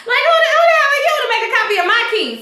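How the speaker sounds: mock indignant outburst. Esperanza yelling at Ed for copying her key, high and fast. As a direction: rattle it off like an offended girlfriend.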